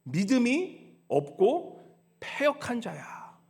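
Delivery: drawn-out, word by word; slow rebuke. Acted. Jesus rebuking the disciples, very slow. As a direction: let each word of the rebuke sink in. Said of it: "뭐라고 말씀하십니까?".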